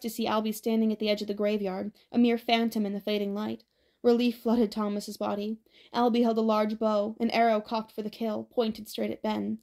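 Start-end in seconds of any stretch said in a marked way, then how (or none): none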